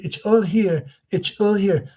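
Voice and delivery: high, mouth-placed mock Trump. Shown as a demonstration. Impressionist showing what people do wrong, high forward voice. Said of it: "it's out of the mouth".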